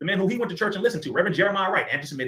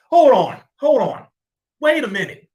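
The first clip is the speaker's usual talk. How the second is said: sharp, raised hold on; loud interruption; 7 semitones higher, 9 dB louder than usual. Real, not acted. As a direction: cut in loudly. Interrupts to challenge a claim.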